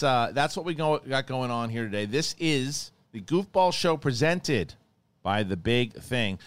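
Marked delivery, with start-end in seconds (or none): 2.1-6.4 slow announcer read; show sign-off. Acted. Host closing the episode, slow announcer read. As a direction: announce the show name slowly.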